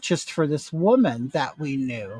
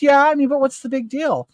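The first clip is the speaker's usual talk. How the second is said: imitating a dismissive wife, much higher voice; dismissive retort; 8 semitones higher, 3 dB louder than usual. Wife brushing off husband's upset over the card, mock dismissive. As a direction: pitch it up, brushing off the complaint.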